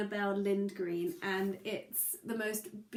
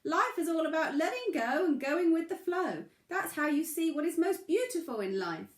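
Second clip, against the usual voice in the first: high, louder crayfish giving advice; wise crayfish; 8 semitones higher, 5 dB louder than usual. Acted. Crayfish advises the struggling pebble, high wise voice. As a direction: speak up like a small wise creature. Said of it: "said the crayfish".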